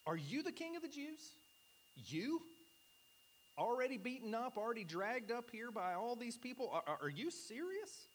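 Pilate can't believe this beaten man is a king; incredulous exclamation. Retold or imitated. Preacher voicing Pilate's disbelief at the beaten Jesus, incredulous and high. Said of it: "an incredulous exclamation".

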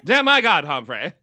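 put-on loud old-Hollywood transatlantic accent; a loud Bogart-era movie line in transatlantic. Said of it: "go for transatlantic".

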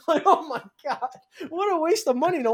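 high mock disbelief; mocking the monitors, incredulous. Real, not acted.

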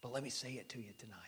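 quick hushed whisper, fast. Real, not acted. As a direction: rush it out in a whisper. Preacher whispers quickly to congregation.

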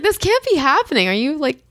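high, dragged-out disbelief; incredulous disbelief. Retold or imitated. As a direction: act out being unable to believe it. Acted disbelief at receptionist's question.

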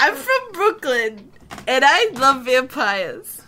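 put-on slow, lightly done New York Brooklyn accent; a hint of Brooklyn, drawn out; playing Brooklyn Allison, the new intern, with a slow New York accent. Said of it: "I can't do this accent".

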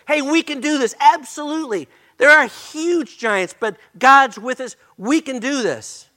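high-pitched, emphatic encouragement; rally doubters with excited conviction; acted-out eager can-do report, punched high; confident rallying cry. Acting out two confident spies' report.

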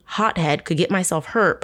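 ordinary talk, nothing marked

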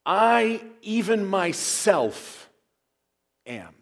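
solemn emphasis on "I, even myself, am."; emphatic I am. Shown as a demonstration. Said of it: "a Greek speaker would have heard this this way".

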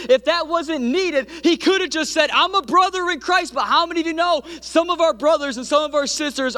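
high-pitched, soaring sermon delivery; full-force preaching. Real, not acted. Preacher presses the congregation on brothers versus partners, impassioned and high. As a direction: climb to a fevered pitch, then come down at the end.

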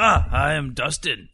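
loud, halting robot intro; loud mechanical self-introduction. Acted. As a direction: announce yourself like a clunky machine. Player voices robot introducing himself.

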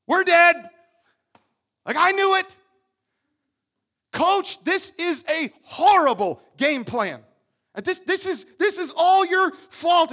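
loud, high panicked wail at the coach; panicked outcry. Acted. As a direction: voice the Israelites freaking out, loud and high. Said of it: "They freak out".

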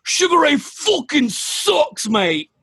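high, slow Manchester heckle; mock Mancunian heckler yelling from the crowd; Mancunian accent. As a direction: jeer it in a broad Mancunian accent. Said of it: "like, Mancunian accent going".

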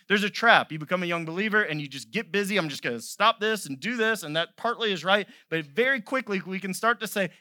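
loud, high, driving sermon voice; full-force preaching. Acted. Pastor voicing Jesus to the congregation, loud preaching. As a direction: preach it out to the back row.

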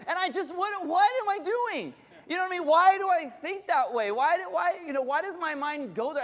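high-pitched bafflement at oneself; worked-up, incredulous and high; exasperated self-questioning. Acted. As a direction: question yourself in rising exasperation. Questioning own irrational thoughts.

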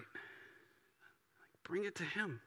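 whispered urging. Real, not acted. Pastor urging the congregation in a whisper to pray. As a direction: breathe it out, bring it to him. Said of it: "whispered urging".

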